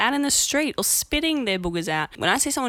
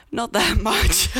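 giggling through it; laughing; 10 semitones higher, slower than usual. Real, not acted. Laughs at own burping.

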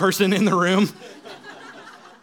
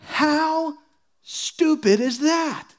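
incredulous; 8 semitones higher, much slower than usual. Real, not acted. Preacher mocking a silly habit.

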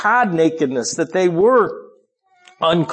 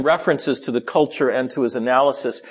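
normal talking voice

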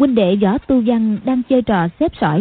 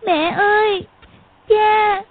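put-on high child's voice crying out; 11 semitones higher, slower than usual. A narrator's reading. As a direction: wail for her parents. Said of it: "chỉ khóc gọi".

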